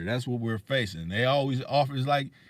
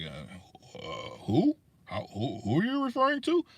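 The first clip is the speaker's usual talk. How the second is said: playing dumb; 4 semitones higher than usual. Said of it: "I get like them".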